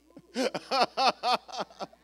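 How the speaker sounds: big rhythmic belly laugh; hearty, ringing laughter; loud laugh. Real, not acted. Laughs between the two takes.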